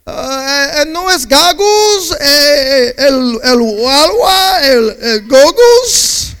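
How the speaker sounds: hammed-up Spanish accent, loud, high and slow; mock Spanish accent. Answering the pool guy back in a mock Spanish accent. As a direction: play up a broken Spanish accent, crying out. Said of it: "I started speaking back in a Spanish accent".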